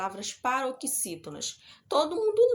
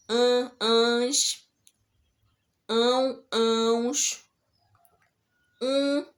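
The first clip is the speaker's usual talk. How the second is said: each ending called out louder, set apart by pauses; emphatic endings; 5 dB louder, much slower than usual. Shown as a demonstration. Teacher to pupils, calling out word endings loudly one by one. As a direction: spell out every ending firmly so the class catches it.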